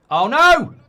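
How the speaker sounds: sudden loud, high "Oh no!"; a fan yelping as the ball nearly goes in; startled shout; real gasp of alarm. Real, not acted. Near miss on goal.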